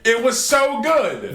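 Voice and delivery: high, slow, loud swoon; gushing repeat. Real, not acted. Savoring the cool demon scene with the cohost, high and drawn out. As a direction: stretch it out in delight.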